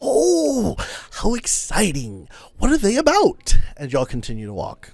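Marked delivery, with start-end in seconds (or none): 0.0-3.6 high delighted oh, then curious; a hint of an excited old man; lightly voiced eager old traveler. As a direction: perk up at a fellow writer. Volo reacting to fellow author.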